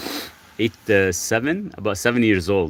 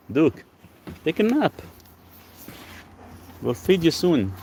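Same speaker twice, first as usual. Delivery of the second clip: higher, cooing voice for the dog; high dog-talk voice; 8 semitones higher than usual. Real, not acted. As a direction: coo at the dog to calm him.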